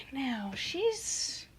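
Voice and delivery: ordinary delivery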